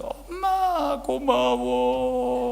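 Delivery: high, very slow tearful thanks; crying. Retold or imitated. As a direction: thank mom through tears. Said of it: "딸이 울면서".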